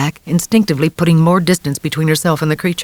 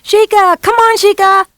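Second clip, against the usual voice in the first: put-on high, loud boy calling a horse; shouting after the mare; 6 dB louder, slower than usual. A narrator's reading. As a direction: call across the field. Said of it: "Joey called out to her".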